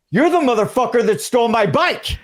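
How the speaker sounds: shouted accusation. Retold or imitated. In the audition scene at the other actor, a loud yell. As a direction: yell it across the fake bar. Improvised bar scene reunion.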